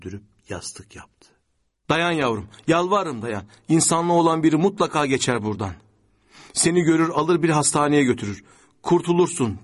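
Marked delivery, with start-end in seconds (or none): 1.9-9.6 loud, high-pitched trembling plea. A narrator's reading. Begging a wounded child to hold on, voice trembling. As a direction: plead with a trembling voice, raised and urgent. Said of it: "dedi sesi titreyerek".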